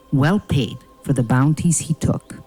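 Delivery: ordinary talk, nothing marked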